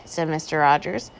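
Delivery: plain reading voice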